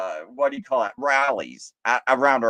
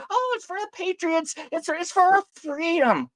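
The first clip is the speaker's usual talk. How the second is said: much higher, sing-song mock innocence; mock naive patriot. Mocking those who sold the Patriot Act, high put-on voice. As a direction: parrot them in a high, silly voice.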